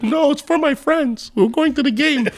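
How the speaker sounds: put-on high-pitched kid refusing; squeaky-high mimicked refusal; imitated kid. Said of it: "He's like".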